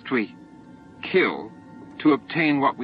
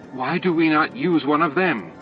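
higher-pitched, near-desperate plea; plead, just short of desperation; almost desperate; 7 semitones higher, 4 dB louder than usual. A narrator's reading. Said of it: "asked Torque almost desperately".

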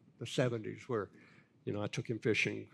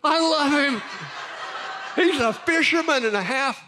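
very high, loud mock-gushing; comic about-face, shouting his love for the rival; gushing conversion; 11 dB louder than usual. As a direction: burst out with overdone love for the man. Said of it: "And I go".

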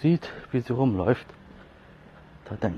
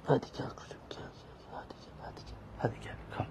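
whispered coaxing to the cat; whispering; 2 semitones higher, 10 dB quieter than usual. Real, not acted. (geflüstertes Locken)

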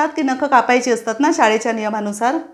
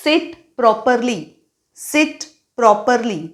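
slow teacher command; much slower than usual. Shown as a demonstration. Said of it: "Sit म्हणजे बसणे".

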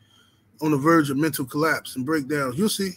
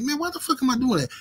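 incredulous self-question; 9 semitones higher, faster than usual. Retold or imitated. Retells his own inner reaction.

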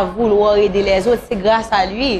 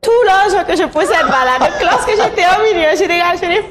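animated insistence; 9 semitones higher than usual. Real, not acted. Animated insistence, others laugh.

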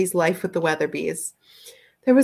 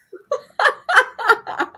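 high burst of laughter; 6 dB louder than usual. Real, not acted. Laughs at the sneer line.

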